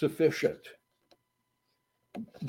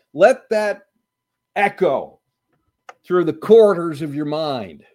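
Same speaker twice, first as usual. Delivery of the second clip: higher, louder challenging preaching; emphatic preaching; 4 semitones higher, 5 dB louder than usual. Acted. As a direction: press the question on them.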